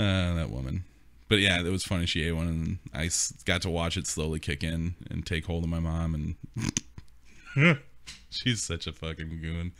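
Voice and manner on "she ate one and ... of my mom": ordinary delivery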